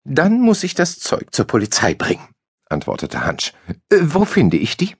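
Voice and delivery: a nervous clerk's voice; timid Hansch. A narrator's reading.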